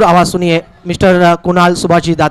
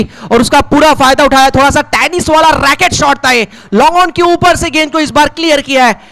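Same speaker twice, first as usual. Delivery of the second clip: excited commentary; 5 semitones higher than usual. Real, not acted. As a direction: lift it for the maximum. Six hit, commentator rises. (उत्साहित ऊँची commentary)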